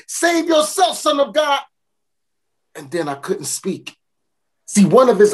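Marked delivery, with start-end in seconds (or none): 0.1-1.6 high taunting shout; mocking shout. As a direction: jeer at him as he passes. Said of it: "And I shouted".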